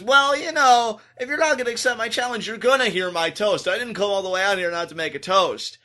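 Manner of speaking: Roman's taunting. Retold or imitated. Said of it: "Roman says".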